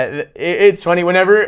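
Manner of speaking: usual speaking voice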